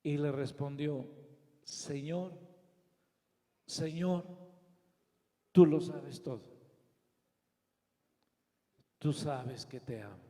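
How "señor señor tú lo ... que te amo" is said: low, halting, subdued plea; saddened. Acted. Pedro, grieved at the third question, answering the Lord sadly. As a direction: answer sorrowfully, with long pauses. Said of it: "se entristeció".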